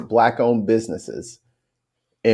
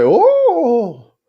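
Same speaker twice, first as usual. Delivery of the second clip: put-on surprised girl; 11 semitones higher, 5 dB louder than usual.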